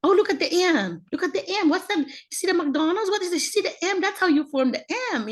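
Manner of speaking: put-on high-pitched excited pointing-out to children; acted enthusiasm for little kids; eager teaching parent. Acts herself teaching kids eagerly.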